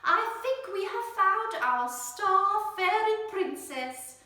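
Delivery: slow, high, loud lilting announcement; sing-song teacher. Acted. Teacher crowning her star fairy princess, sing-song. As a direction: sing out the big news.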